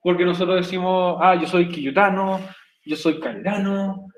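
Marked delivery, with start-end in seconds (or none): none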